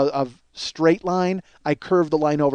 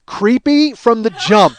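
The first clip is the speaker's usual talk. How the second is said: loud, drawn-out verdict; slow, raised-voice self-reproach; exasperated self-mockery; 4 semitones higher, 8 dB louder, much slower than usual. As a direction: announce your own failure loudly. Berates himself for creepy opener.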